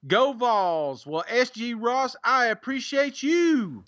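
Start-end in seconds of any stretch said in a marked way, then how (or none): 2.2-3.8 high, drawn-out sing-out thanks; showy sign-off to a reviewer; catchphrase flourish. Real, not acted. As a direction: belt out your catchphrase, pitched way up then falling. Same catchphrase to next reviewer.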